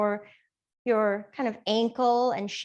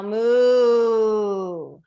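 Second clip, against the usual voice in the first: mooing like a cow; 8 dB louder than usual. Shown as a demonstration. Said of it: "And on your cow".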